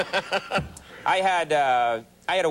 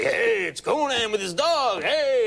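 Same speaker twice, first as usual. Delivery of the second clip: hearty firemen; 8 semitones higher, slower than usual. Retold or imitated. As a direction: hail a celebrity like a buddy from the firehouse. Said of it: "they're like".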